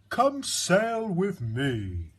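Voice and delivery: a gentle giant inviting the snail aboard; whale's invitation. A narrator's reading. Whale invites the snail to sail, slow and grand. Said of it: "and said to the snail".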